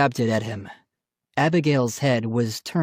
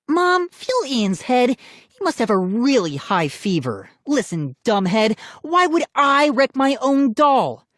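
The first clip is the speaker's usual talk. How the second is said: high, indignant little-sister retort; mock him, then demand indignantly; scornful; 12 semitones higher than usual. A narrator's reading. Sister rebutting brother's accusation before mom, scornful.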